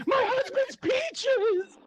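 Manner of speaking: very high, drawn-out mock shriek; alarmed wife impression. Wife crying out over her grabbed husband, hammed-up falsetto shriek. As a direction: stretch it out in a piercing falsetto wail. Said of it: "my impression of that woman".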